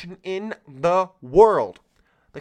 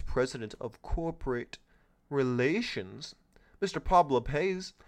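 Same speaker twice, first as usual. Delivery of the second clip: proper reading voice. Shown as a demonstration. Host reads the prison company article in a formal announcer voice. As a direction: read it like a stiff news anchor. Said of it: "let me get in a proper voice here".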